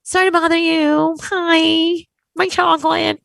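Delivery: high, sugary customer-service politeness; mock sweet voice. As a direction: put on a sweet, high phone voice. Sweet fake-polite customer call.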